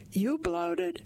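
high, rising incredulous question; incredulous butting in. A narrator's reading. Man butting into women's talk of bloating, incredulous. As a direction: echo it in disbelief, pitch leaping up.

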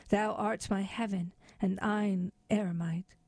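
plain reading voice

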